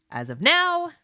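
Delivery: as of now jumps high and loud; emphatic. Real, not acted. Host stressing the present, high loud emphasis. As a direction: hit it hard and high.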